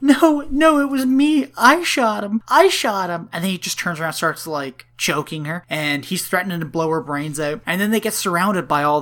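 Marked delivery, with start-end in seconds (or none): 0.0-3.3 mock pleading shout. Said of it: "Cam's like".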